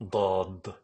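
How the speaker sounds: normal talking voice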